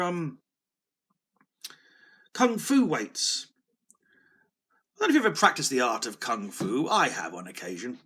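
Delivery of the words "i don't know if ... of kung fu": ordinary delivery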